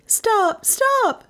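very high cry of protest; high-pitched cry. A narrator's reading. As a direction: cry out high, begging him to stop. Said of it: "Melissa cried".

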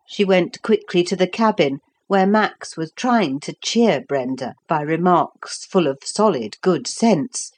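ordinary delivery

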